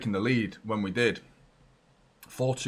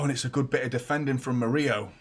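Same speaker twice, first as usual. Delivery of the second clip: usual speaking voice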